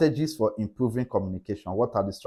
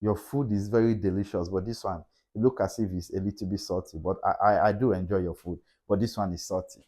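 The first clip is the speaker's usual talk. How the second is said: gentle correction; 6 semitones lower, 5 dB quieter than usual. Shown as a demonstration. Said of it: "You say".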